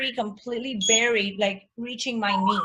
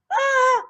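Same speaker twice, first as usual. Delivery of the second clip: a sudden scream, much higher and louder; panicked scream; 5 dB louder than usual. Retold or imitated. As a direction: yell out in panic while running. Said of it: "like that".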